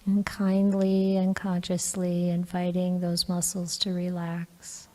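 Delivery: calm, even meditation guidance with long pauses; guided meditation. Real, not acted. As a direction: gently guide them into relaxing, leaving long silences.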